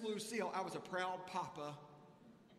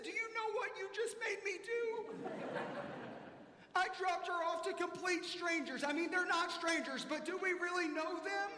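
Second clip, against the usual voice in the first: higher, louder exasperated rant; exasperated venting; 12 semitones higher, 5 dB louder than usual. Retold or imitated. Dad venting to his wife about drop-off, higher and louder. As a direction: complain in mock despair.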